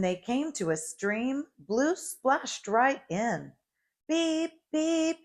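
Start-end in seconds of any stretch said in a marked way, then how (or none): 4.1-5.2 grinning beep. A narrator's reading. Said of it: "he said, with a little blue grin".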